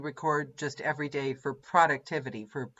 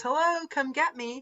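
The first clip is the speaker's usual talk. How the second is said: much higher, teasing call to fate; mock taunt to the universe; 13 semitones higher than usual. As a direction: dare the universe to come get you, playfully. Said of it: "like tempting the universe".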